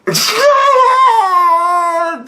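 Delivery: loud, high howl of laughter. Real, not acted. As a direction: let out a big shouting laugh. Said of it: "I'm fucking howling".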